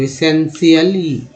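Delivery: एसेंशियली said slowly, ending in a clear ली; slow demo word. Shown as a demonstration. Teacher models the word for learners, slowly. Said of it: "L Y को ली".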